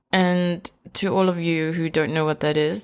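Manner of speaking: usual speaking voice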